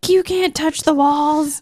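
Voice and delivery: high, drawn-out mock protest; slow indignant whine of a put-on pastor; imitated protesting pastor. Pastor objecting to touching the walls, mock indignant.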